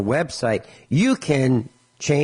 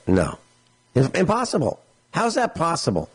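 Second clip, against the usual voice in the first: incredulous protest; 5 semitones higher than usual. Retold or imitated. Retelling disbelief at suspended license.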